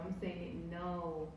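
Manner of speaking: ordinary talk, nothing marked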